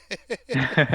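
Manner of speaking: short burst of real laughter; laughing. Real, not acted. Amused at the walking simulator joke, laughing.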